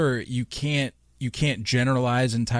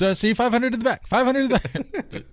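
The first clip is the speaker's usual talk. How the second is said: fast, high auctioneer call; 13 semitones higher than usual. Retold or imitated. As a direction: take bids like an auctioneer. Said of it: "like an auctioneer".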